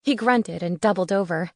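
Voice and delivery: usual speaking voice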